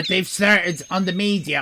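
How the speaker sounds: ordinary talk, nothing marked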